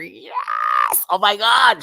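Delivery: loud, high fan scream; excited screaming. Real, not acted. Fan learning a favourite's season sister returns, excited scream. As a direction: shriek with excitement at great news. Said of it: "I'm just screaming right now".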